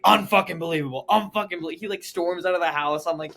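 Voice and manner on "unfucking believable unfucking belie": loud, pitched-up ranting; imitating a father storming off; angry dad. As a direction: rant loudly in mock fury. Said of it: "He, like, gets angry".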